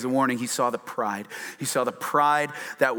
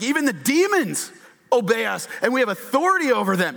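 excited boast; 8 semitones higher, 3 dB louder than usual. Retold or imitated. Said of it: "The disciples were like".

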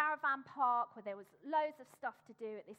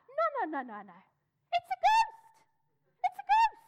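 shrill, much higher, louder ghost shriek; panicked scream; 13 semitones higher, 9 dB louder than usual. Acted. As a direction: scream it in terror.

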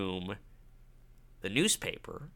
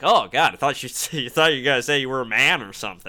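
relieved rambling blurt; 3 semitones higher, 8 dB louder, faster than usual. Retold or imitated. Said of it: "And he was like".

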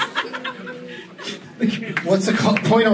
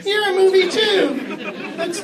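high silly voice; slower than usual. Acted. Joking high voice at audience.

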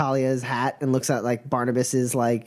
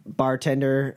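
normal talking voice

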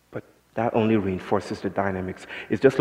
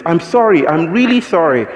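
imitating a flustered man, higher and louder; mock the German guy's panicky sorry; frantic apology; 7 semitones higher, 8 dB louder than usual. German man apologising to the speaker, hammed-up flustered sorry. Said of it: "he was shocked and was like".

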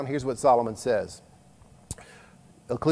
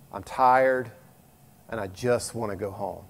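tired sigh; 5 semitones lower, 4 dB quieter than usual. Retold or imitated. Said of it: "the number one phrase I hear people say".

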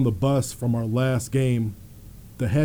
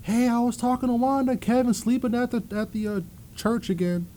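high, quick mom's voice; chatty mother, pitched way up; mom on the phone; 10 semitones higher than usual. Retold or imitated. Mom phones her son with news, high and chatty. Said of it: "my mom would always call me and say".

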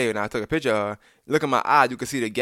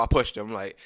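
ordinary delivery

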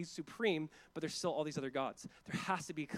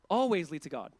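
mock claim; 8 dB louder than usual. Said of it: "It's like, how dare you claim".

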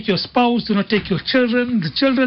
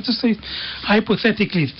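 usual speaking voice

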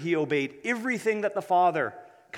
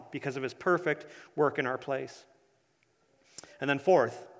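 ordinary talk

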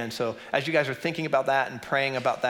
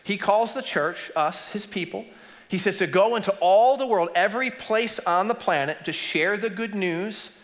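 fiery preaching; 6 semitones higher than usual. Real, not acted. Preaching at full force.

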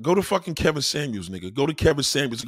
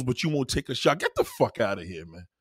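normal talking voice

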